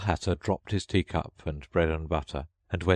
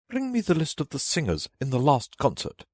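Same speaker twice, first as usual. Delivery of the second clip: higher, commanding queen voice; a lofty royal demand, pitched up; imperious Queen; 8 semitones higher than usual. Acted. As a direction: order it like a haughty queen.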